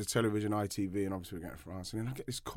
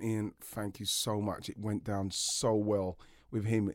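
grateful caller; 2 semitones lower, 5 dB quieter, slower than usual. Retold or imitated. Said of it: "these people saying".

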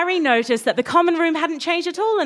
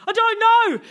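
exasperated cry; 10 semitones higher than usual. Acted. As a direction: wail it out up high. Harry exasperated while pulling.

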